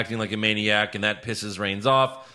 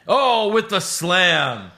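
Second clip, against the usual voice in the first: slow, much higher mock announcer voice; Michael Cole; 8 semitones higher, 6 dB louder, much slower than usual. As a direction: stretch it out, hammy commentator. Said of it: "as Michael Cole called them".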